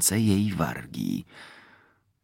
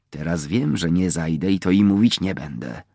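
ordinary delivery, nothing marked

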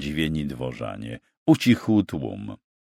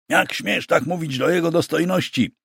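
indignant thundering; 9 semitones higher than usual. A narrator's reading. Bishop's secretary scolding an insolent knight, indignant. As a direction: rebuke him in a raised, outraged voice. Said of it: "zagrzmiał sekretarz Oleśnickiego".

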